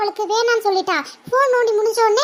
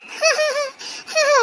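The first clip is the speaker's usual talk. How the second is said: a high laugh that dares the mother; high jeering giggle on demand; defiant mocking laugh; 8 semitones higher than usual. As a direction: laugh right back to provoke her. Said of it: "எங்க மறுபடியும் சிரி பாப்போம்".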